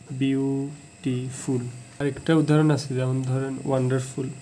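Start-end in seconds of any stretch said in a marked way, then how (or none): 0.1-1.6 beautiful very slowly in three parts, বিউ, টি, ফুল; syllable by syllable. Shown as a demonstration. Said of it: "এটাকে আমরা তিনবারে". (Beautiful ধীরে তিন ভাগে ভেঙে বলা)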